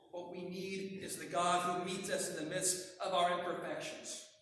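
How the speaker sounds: ordinary delivery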